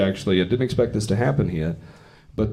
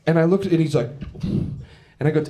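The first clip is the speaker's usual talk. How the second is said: ordinary talk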